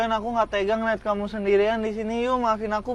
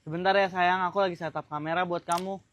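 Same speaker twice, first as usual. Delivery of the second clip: soft, lower gentle voice; calm your partner quietly; tender and unhurried; patient soothing; 3 semitones lower, 6 dB quieter than usual. Acted. First take, he soothes her.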